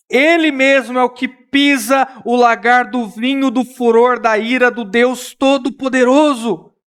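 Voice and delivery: normal talking voice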